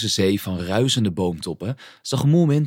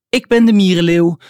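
high, loud boastful announcement; proud shout; 8 semitones higher, 9 dB louder than usual. A narrator's reading. Mie announces her part in the play, proud shout. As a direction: proclaim it at full voice, sustained and high. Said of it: "riep kleine Mie trots".

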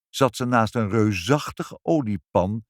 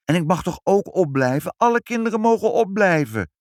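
put-on high, louder begging girl; pleading child; 10 semitones higher, 4 dB louder than usual. Girl begs her parents to stay up, high and pleading. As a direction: beg to stay up like a little girl. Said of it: "smeekte Ibbeltje".

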